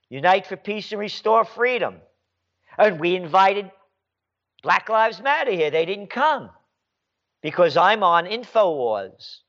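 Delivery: ordinary talk, nothing marked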